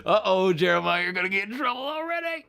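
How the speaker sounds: mock warning, rising and rushed; playful teasing. Real, not acted. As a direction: wag a finger at the viewer, laughing. Teasing a viewer in chat.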